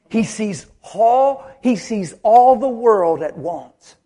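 slow, drawn-out, emphatic; solemn slow proclamation; slow declaration. Acted. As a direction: let each word land slowly.

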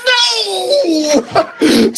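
high laughing mock "No!"; mock shriek. Retelling a woman's panicked reply, high laughing mock no. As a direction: squeal it high like her, laughing. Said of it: "She goes".

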